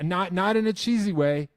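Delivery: high, loud whiny apology; mock apologetic fan. Mocking fans who apologise in advance, high and loud. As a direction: imitate a nervous fan begging not to be judged. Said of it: "where they're like".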